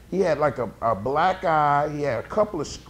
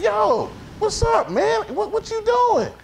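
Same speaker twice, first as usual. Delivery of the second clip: very high, loud squeal of objection; retold startled protest; 5 dB louder, slower than usual. Retold protest at tongue in ear.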